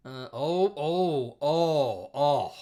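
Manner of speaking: loud, drawn-out dawning shock; stunned and loud, stretching the words; real astonishment. Real, not acted. Stunned by the search result.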